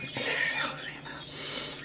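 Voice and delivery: slow, very soft whisper; breathy whispered exhale. Shown as a demonstration. Coach guiding listeners through deep breathing, whispering. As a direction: whisper it slowly on the outbreath.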